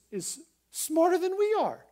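high emphatic. Real, not acted. Preacher emphatic point.

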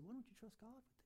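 hushed voice of the Spirit; small still voice. Retold or imitated. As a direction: breathe it like a quiet inner voice. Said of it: "still voice said".

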